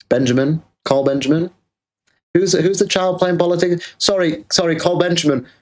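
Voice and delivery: high, teasing call by full name; mocking taunt. Real, not acted. Streamer calling out Carl Benjamin by name, high teasing taunt. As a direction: needle him, sing his name up high.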